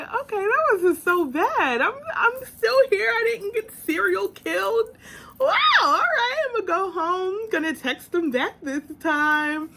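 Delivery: playing her own relief after the date, lightly; relieved surprise. Her relieved inner thoughts.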